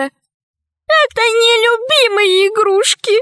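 high, slow, drawn-out pout; petulant, high-voiced lament; childish whining. A narrator's reading. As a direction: drag it out like a sulking child. Childish heiress whines about favourite toys.

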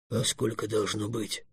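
low, slow, very soft indignation; quietly affronted, taking his time; indignant dragon. A narrator's reading. Dragon answering a remark on his two paws, quietly indignant. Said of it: "возмутился дракон".

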